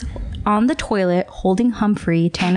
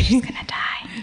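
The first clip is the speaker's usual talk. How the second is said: whispered, soft and breathless; 2 semitones higher, 4 dB quieter, slower than usual. Pretend. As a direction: whisper it like a secret. Whispered echo of the line.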